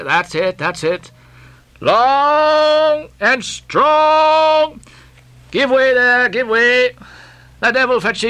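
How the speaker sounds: high, long-drawn rowing calls; Stubb coaxing and scolding. A narrator's reading. Stubb driving his rowers to pull, coaxing and scolding. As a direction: urge the crew on with stretched cries.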